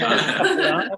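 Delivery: laughing, high Scouse accent. Shown as a demonstration. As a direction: say chocolats like a Liverpudlian, giggling. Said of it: "say it in a Scouse accent".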